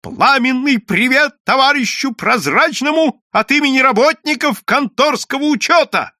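high, loud rally greeting; shouting to the crowd. A narrator's reading. Bookkeeper greeting the crowd, loud and high. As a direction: hail the crowd at full voice. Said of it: "крикнул в толпу".

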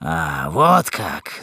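low, slow menace; deep, drawn-out threat; threatening rough brute. A narrator's reading. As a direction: drag it out low as a coarse bully. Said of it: "закричал тот же голос".

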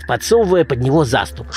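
ordinary delivery, nothing marked

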